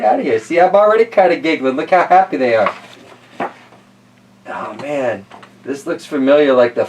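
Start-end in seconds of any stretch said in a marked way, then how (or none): none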